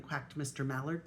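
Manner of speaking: usual speaking voice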